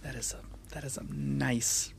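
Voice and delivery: soft awed whisper. Real, not acted. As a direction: whisper it, impressed. Reacting to strong beer.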